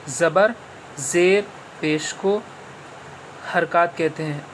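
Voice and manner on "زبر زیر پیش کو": slowed, each word spelled out for students; slow and clear. Shown as a demonstration. Teacher repeating for pupils, slow and clear. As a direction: say it slowly so the class hears it again. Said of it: "پھر سے سن لیجئے".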